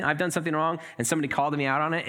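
usual speaking voice

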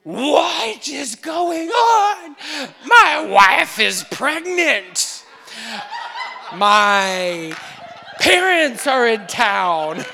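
hammed-up, drawn-out high shout; loud theatrical meltdown; panicked overreaction. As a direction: bellow it in mock outrage. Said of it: "what's your natural response".

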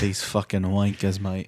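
low, slow, soft British grumble; mock British accent. Co-host voices Chris Cage, mock British accent. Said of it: "in Britain talks".

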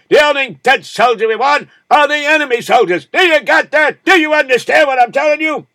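shouting drill sergeant, high and loud; harsh barking commands. Retold or imitated. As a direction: bark orders at exhausted recruits. Drill officer yelling at trainees.

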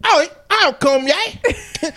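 put-on slow, drawn-out Australian accent; mock Australian accent. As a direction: do a cheesy Australian voice. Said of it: "Australian would be like".

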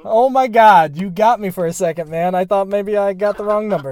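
loud, high, relieved outburst; excited. Real, not acted. Relieved surprise when a friend answers.

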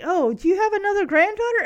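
high, loud, quick eager ask; eager question. Retold or imitated. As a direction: pounce on it hopefully. Retold hopeful question at auction.

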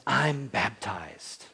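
very slow, drawn-out declaration; declaring. Acted. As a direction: draw each word out as a proclamation.

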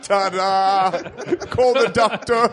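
hammed-up performer's announcement; circus showman. Imitating circus geek act.

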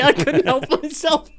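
laughing while telling it, high and loud; laughing recount. Real, not acted. Comedian confessing to mimicking a therapist, laughing. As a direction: crack up at your own story. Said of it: "full Cockney geezer".

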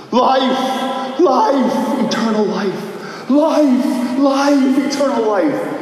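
"Life, life, eternal life" cried out high and loud; shouting to himself. Retold or imitated. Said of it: "he starts shouting to himself".

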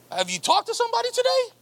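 high, quick disbelieving question; incredulous retort. Real, not acted. Preacher firing back at the lonely complainer, high and fast.

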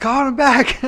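high, loud teasing echo; excited mimic. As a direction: squeak it back mockingly. Mocking co-host's eager callback.

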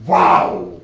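big, loud, low "wow"; awed amazement. Retold or imitated. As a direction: let one drawn-out word land with awe. Class's eyes opened by the exercise.

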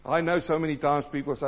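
ordinary talk, nothing marked

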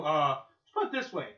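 usual speaking voice

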